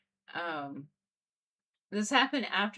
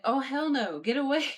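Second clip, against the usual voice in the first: higher, louder alarmed protest; retold yelling; 7 semitones higher, 6 dB louder than usual. Teller relives shooing off the orb, yelling in alarm. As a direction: re-enact yelling something away. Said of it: "I just kept yelling at it".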